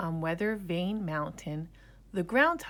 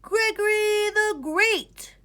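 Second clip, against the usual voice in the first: high, loud, slow pompous announcement; boy grandly admiring himself; grand self-title; 10 dB louder, slower than usual. A narrator's reading. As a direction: proclaim yourself to the mirror.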